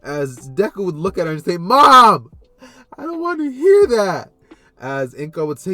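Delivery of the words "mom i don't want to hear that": loud, high-pitched whine of an embarrassed son; mortified yell at mom; horrified protest. Acted. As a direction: cry out in protest, dragging the words.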